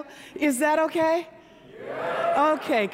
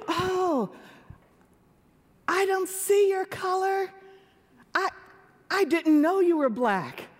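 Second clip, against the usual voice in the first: higher, mock-sweet voice of a well-meaning stranger; sixty-year-old woman mimicking people who claim not to see color; 9 semitones higher than usual. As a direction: gush it like a clueless compliment.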